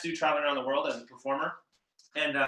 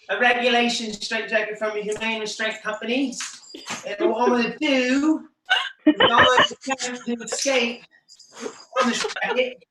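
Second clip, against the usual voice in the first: high, put-on English accent for a stage character; bad English accent; 7 semitones higher, 6 dB louder than usual. Magician in character introducing his escape, mock English accent. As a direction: announce the stunt in a hammed-up English accent. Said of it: "I was talking to him like this".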